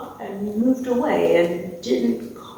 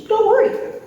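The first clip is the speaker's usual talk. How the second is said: high-pitched, louder burst of reassurance; chirpy reassurance; 14 semitones higher, 5 dB louder than usual. Acted. Taxidermist reassuring the chicken's owners, high and chirpy. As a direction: sing out comfort in a much higher voice.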